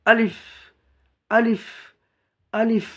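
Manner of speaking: short, speedy আলিফ; no elongation. Shown as a demonstration. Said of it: "স্পিডে পড়তে হবে, কোনো টান হবে না".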